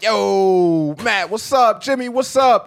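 loud, high hail to buddies; exuberant greeting. Retold or imitated. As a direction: greet each friend with a big shout. Acts out greeting a line of friends.

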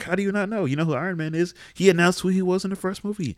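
high-pitched, indignant disbelief; incredulous. Acted. Fan scolding a kid about Iron Man, incredulous. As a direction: ask it as if it's absurd not to know.

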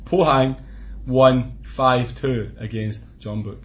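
Pohang and Jeonbuk said slowly, each word spelled out; slow and clear. Shown as a demonstration. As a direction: repeat it slowly for the listeners. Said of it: "For one more time".

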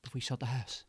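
cheesy ad read, loud and upbeat; advert pitch. Acted. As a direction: sell it like a late-night advert. Spiritual healers' advert pitch.

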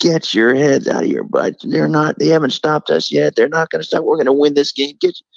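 high-pitched yelled pep talk; halftime pep speech. Retold or imitated. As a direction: fire the team up at halftime. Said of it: "he starts yelling at us".

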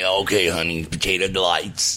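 put-on low raspy old waitress voice; Agnes waitress voice. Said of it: "That sounded like Agnes".

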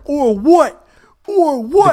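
high, loud repeated challenge; hyped-up. Retold or imitated. Storyteller showing how hyped he really was, loud hyped yell. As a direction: re-enact being fired up, shouting the dare. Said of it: "I was hyped in a bitch".

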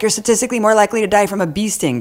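high, fast, mocking quote; mock know-it-all retort. Host mimics people who came at her, mocking. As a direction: rattle it off as a mocked critic.

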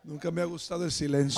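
soft, low-pitched confession; hushed aside. Real, not acted. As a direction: say it low and quietly to a silent room. Hushed confession to silent church.

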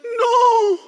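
high, loud cry of No; Belle's anguished cry. Retold or imitated. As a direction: shriek it like a desperate princess. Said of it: "That's my Belle voice".

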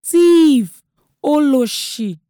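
high, very loud accusing cry; furious, drawn-out shout of abuse; shouted name-calling. A narrator's reading. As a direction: yell each insult after someone fleeing. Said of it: "still calling me names".

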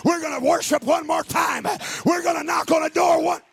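rally the church, climbing to a roar; full-force preaching. Acted. Pastor rallying his church to rise up, shouting.

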